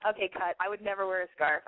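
usual speaking voice

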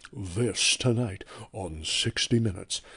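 slow, low newsman sign-off; measured anchor intro, slowed down; Scott Pelley voice. Shown as a demonstration. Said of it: "just say".

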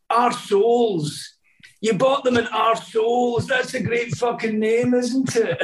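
high-pitched imitation of a comedian's delight; Billy Connolly. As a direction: play the comedian relishing the name, pitched high. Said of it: "He went".

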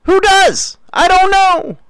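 very high, incredulous voice; baffled squeal. Real, not acted. Host baffled by who dreams up such stunts, high-pitched bafflement. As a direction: shoot way up high in disbelief.

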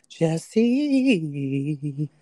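drawn-out ghostly call; unearthly voice. Acted. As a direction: call the name like a ghost, stretched long. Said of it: "Did I sound like a ghost just now?".

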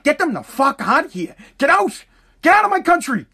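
furious get-out yell; angry outburst. Real, not acted. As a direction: throw them out, voice rising. Ranting demand at opponents.

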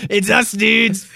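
slow, high put-on voice of the hormones; a slow, high cartoon villain voice; character voice. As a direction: play the mischievous hormones.

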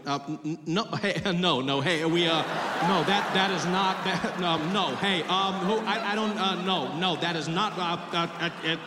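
flustered stammer breaking down into stuck syllables; short-circuiting. Retold or imitated. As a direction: glitch like an overloaded machine mimicking a polite denial. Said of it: "as if they're short-circuiting".

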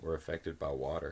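usual speaking voice

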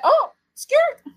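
squeaky high mock-shocked "Oh, skirt"; surprised king. King finding out his servant didn't forgive, mock shock. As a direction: jump way up in pitch, hammed-up shock. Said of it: "the king found out and was like".

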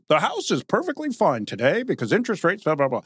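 coolly brushing off a burning house; mock calm anchor. Mocking business journalists who sound calm during the crisis, lightly voiced. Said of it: "you were just calmly being like".

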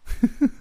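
short high cackle; acted cackling laugh. A narrator's reading. Narrator laughing at startled friend, brief high cackle. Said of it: "I cackled".

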